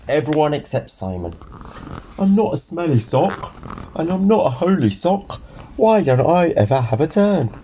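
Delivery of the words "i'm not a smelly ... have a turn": higher, protesting little character voice; a touch of plaintive complaint; lightly voiced indignant sock. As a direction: plead your case, pitched up. Sock protests being left out.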